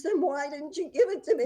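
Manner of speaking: high, loud mock-exasperated demand; hammed-up frustrated mom; retold exasperation. Mother asks kid why the money wasn't given to her, mock exasperation.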